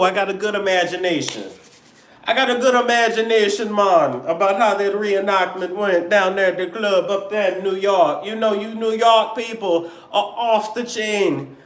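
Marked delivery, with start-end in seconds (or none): 2.2-3.7 playful put-on Jamaican lilt, higher; Jamaican Caribbean accent. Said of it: "I love that Jamaican Caribbean". 3.7-11.6 put-on Jamaican Caribbean accent. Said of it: "I love that Jamaican Caribbean".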